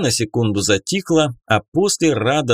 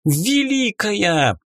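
squeaky pixie; 9 semitones higher, slower than usual. Acted. Fairy thanking the goddess.

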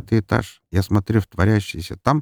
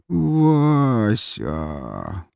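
long, slurred, stretched-out name; drawn-out drunken mumble; 2 semitones higher than usual. A narrator's reading. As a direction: drag both syllables out drunkenly. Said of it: "протяжно промямлил парень".

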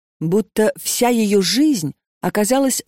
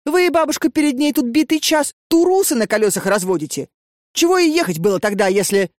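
higher, brash scolding, faster at the end; feisty scolding; 6 semitones higher than usual. A narrator's reading. Marusya brashly scolding the grandmother for wasting time. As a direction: tell the old lady off without ceremony.

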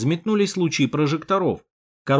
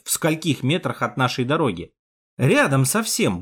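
plain reading voice

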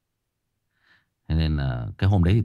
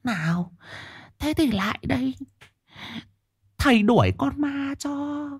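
creepy shaman voice; slower than usual. Shown as a demonstration. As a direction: coax like a sleazy sorcerer. Sleazy shaman villain demo.

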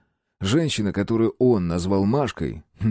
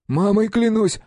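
pleading thief; 13 semitones higher, 4 dB louder than usual. A narrator's reading. Thief swears on his mother.

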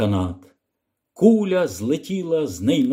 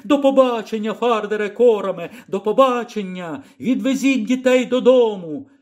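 calling goodbye; 6 semitones higher than usual. Acted. Lyra shouting goodbye from basket.

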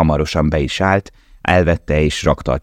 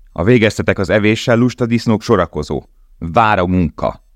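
kapo yelling; 5 semitones higher than usual. A narrator's reading. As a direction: bellow at the lazy pigs. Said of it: "üvöltött a kápó".

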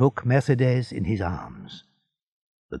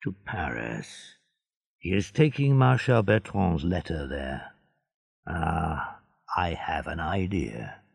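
low, soft scheming aside; soft aside to himself; speaking to himself; 5 semitones lower, 6 dB quieter than usual. A narrator's reading. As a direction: mutter it under your breath. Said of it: "speaking to himself".

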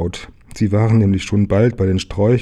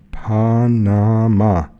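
very slow, drawn-out PA-NA-MA; slow, emphatic reading of Panama; syllable by syllable; 2 semitones higher, 4 dB louder, much slower than usual. Acted. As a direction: spell out Panama in long, separate syllables. Sign read out syllable by syllable.

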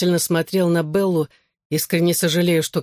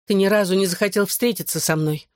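usual speaking voice

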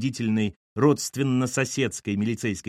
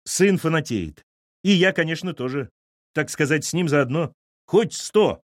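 hearty, raised-voice announcement; proclaiming; 5 semitones higher, 4 dB louder than usual. A narrator's reading. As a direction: announce it grandly, pitched up. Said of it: "возвестил Данила". (торжественно возвещая)

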